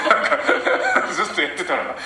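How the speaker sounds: words squeezed out through high laughter; laughing while talking. Real, not acted. Author cracking up describing a villain labelled evil. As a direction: keep laughing as you speak, slow at first.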